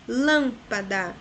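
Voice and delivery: lâmpada slow, LÂM-pa-da with a strong start; stress on first syllable. Shown as a demonstration. Teacher giving an example, lâmpada slowly stressed on lâm. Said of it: "é a terceira".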